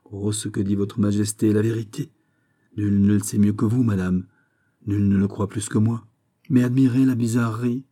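plain reading voice

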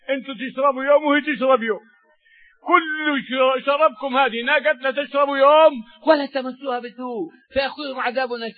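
full-force preaching. Acted. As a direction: proclaim the verse with power. Preacher explains the verse.

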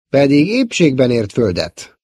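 usual speaking voice

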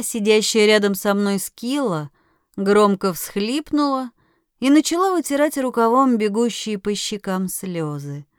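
ordinary delivery, nothing marked

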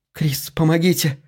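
contained cry of alarm; urgent plea for help. A narrator's reading. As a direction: plead urgently for someone to step in. Daughter calls for help in attack.

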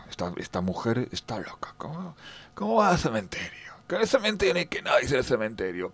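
whispered aside to himself; whispering. Retold or imitated. Narrator privately doubting the old lady, whispered. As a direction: hiss it under your breath in disbelief.

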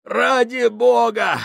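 high, slow pleading shout; desperate plea. A narrator's reading. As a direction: beg loudly for help. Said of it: "стонал Гослинг".

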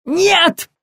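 loud, high panicked squeal; colonel's sudden high shriek. A narrator's reading. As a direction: yelp it sharply in alarm. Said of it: "взвизгнул Дегтярев".

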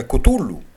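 Κουτούλου as "koo-TOO-loo", plain t for th. Retold or imitated. Lecturer giving a tribe's altered name, variant pronunciation. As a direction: turn the name into Κουτούλου, stressed on the middle syllable. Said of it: "παραλλάσσουν, ας πούμε, το όνομα".

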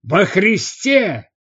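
slow, emphatic bark rising high. A narrator's reading. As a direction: bark it out in exasperation, drawn out. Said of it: "рявкнул отставной хилиарх".

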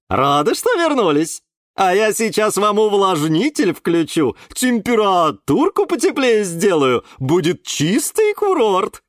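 high, loud, cooing singsong auntie; chatty caretaker. A narrator's reading. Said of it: "приговаривала она". (воркующая хлопотливая тётушка)

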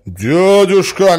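high, loud, drawn-out call; protesting nephew. A narrator's reading. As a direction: appeal to him urgently. (протестующий, громкий оклик)

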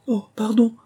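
high, loud startled apology; abrupt high-pitched oops; sudden apology. A narrator's reading. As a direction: catch yourself and apologise, voice leaping up. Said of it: "dit encore Alice".

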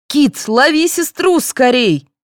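high, drawn-out urgent order; commanding mother. A narrator's reading. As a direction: order it across to your son. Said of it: "велела мать".

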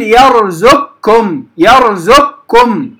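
يرزقكم said slowly as yarzukkum; light k from the first moment of the closure in يرزقكم; light merged kaf. Shown as a demonstration. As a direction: merge ق into a thin, doubled ك in يرزقكم. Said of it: "من أول النطق بالكاف مرققة".

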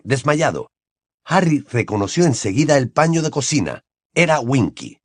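ordinary talk

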